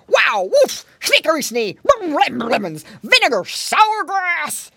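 loud, high-pitched, disgusted bear; bear's cry. A narrator's reading. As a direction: splutter it like a bear with a mouthful of sourness. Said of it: "cried the bear".

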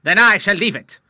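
high, loud cutting refusal; angry refusal. Acted. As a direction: slam the door on the deal. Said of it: "rose angrily".

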